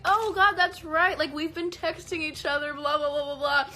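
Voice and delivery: high, loud "oh God" of sudden recognition; mock recognition. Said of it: "And I was like".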